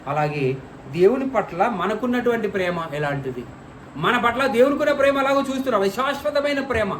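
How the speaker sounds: ordinary delivery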